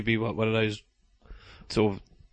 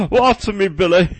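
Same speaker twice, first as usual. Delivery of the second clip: high, loud posh plea; hammed-up posh cabbage; 7 semitones higher, 6 dB louder than usual. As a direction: beg in a mock posh accent. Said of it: "Why have I got all posh".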